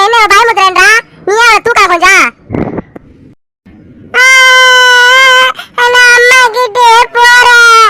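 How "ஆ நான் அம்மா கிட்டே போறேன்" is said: put-on wailing child. Child leaving aunt for mother, wailing. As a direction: bawl like a scared little girl, stretching each ending.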